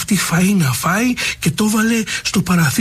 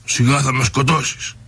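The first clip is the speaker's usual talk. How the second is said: hidden voice; 4 semitones lower than usual. Acted. Hidden lion cub voice.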